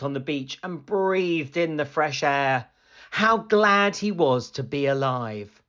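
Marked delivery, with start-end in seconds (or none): none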